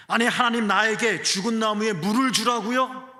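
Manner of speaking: loud, high-pitched protest of disbelief; indignant protest. Acted. Preacher voices a believer questioning God, indignant protest.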